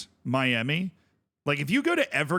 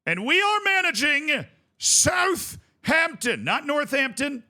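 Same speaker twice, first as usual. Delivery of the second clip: excited shout; 11 semitones higher, 4 dB louder, slower than usual. Real, not acted. Announcing the football club.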